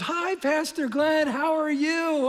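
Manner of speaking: high, slow, bright-eyed church friend greeting; bubbly greeting. Retold or imitated. Church friend greeting the pastor brightly. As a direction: chirp it like an outgoing church lady. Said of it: "a very outgoing, bubbly person".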